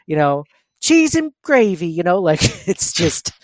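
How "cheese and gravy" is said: high, slow mock Alabama accent; exaggerated Alabama accent, pitched way up. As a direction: newscaster slipping into an Alabama twang off mic. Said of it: "as soon as they're off mic".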